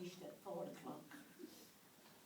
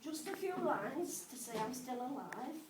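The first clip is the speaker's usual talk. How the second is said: higher, louder recital of a letter; comic letter reading; 4 semitones higher, 9 dB louder than usual. Acted. Mum writes a silly letter to her son, comic letter reading. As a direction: read it out line by line, pausing for each punchline.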